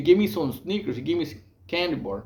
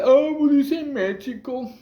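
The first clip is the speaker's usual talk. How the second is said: high mocking taunt; 10 semitones higher than usual. Inmates mocking the Mexican newcomer in the day room, high mocking taunt.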